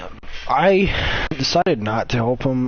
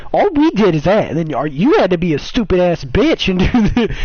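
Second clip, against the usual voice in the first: mock angry rant; 7 semitones higher, 5 dB louder than usual. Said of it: "Brian's sitting there".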